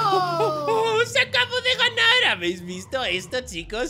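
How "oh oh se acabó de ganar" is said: high, loud excited outburst; triumphant shout. Acted. Racer celebrates in front of friends, triumphant. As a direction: crow at full voice. Celebrates pulling ahead in race.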